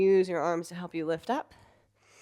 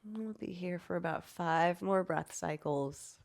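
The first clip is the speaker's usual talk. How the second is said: guided meditation; 6 dB quieter than usual. Acted. Calm guided voice continues.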